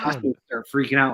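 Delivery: normal talking voice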